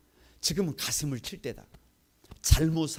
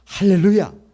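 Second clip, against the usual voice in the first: loud ringing hallelujah; preacher's rousing shout; 4 semitones higher, 14 dB louder than usual. Real, not acted. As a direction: call it out to the congregation.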